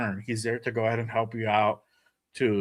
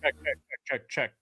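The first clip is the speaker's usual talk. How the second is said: muffled and distant; 6 dB quieter than usual. Real, not acted. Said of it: "you sound muffled and distant".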